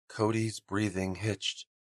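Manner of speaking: plain reading voice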